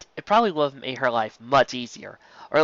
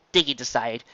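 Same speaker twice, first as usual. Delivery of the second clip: usual speaking voice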